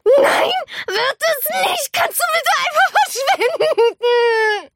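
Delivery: slow, high, tearful shouting; meltdown. A narrator's reading. Girl snaps at her mother from the bathtub, tearful shouting. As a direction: lose it, crying and yelling. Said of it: "rastete aus".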